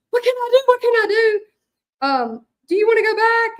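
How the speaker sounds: high, loud, frantic friend voice; panicked friend. Retold or imitated. Chelsea panicking as her friend gets burned, high frantic squeal.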